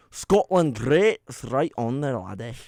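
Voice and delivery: hammed-up Scottish accent. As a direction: put on a playful Scottish lilt. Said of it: "It's a good Scotland one".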